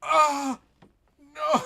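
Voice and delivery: high, loud pained wail of despair; mock anguished cry. Reacting to friends ordering Domino's, hammed-up anguished cry. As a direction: cry out as if the Domino's pizza is a tragedy. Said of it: "And I was like".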